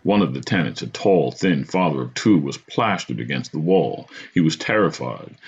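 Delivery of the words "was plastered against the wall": plain reading voice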